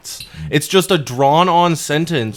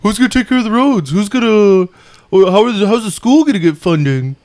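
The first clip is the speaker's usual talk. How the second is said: mocking people's whining; 6 semitones higher, 4 dB louder than usual. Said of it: "When people say".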